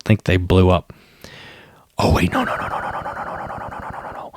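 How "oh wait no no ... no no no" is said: no, no, no trailing off into a breathless whisper; fading to a whisper. Real, not acted. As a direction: keep saying no, softer each time until only breath is left. Reacting as the car nearly explodes.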